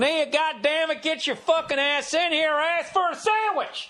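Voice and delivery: hammed-up Southern accent. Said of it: "like, a Southern voice?".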